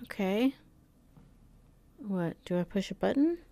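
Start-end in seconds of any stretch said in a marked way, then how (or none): none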